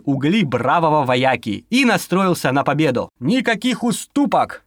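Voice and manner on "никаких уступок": firm. A narrator's reading. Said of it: "твёрдо заявил".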